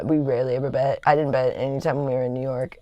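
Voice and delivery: ordinary talk, nothing marked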